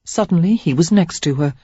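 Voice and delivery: ordinary delivery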